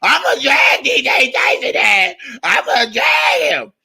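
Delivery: high, screechy mocking old woman; mock the rival lady's screechy voice. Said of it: "scraggly ass voice".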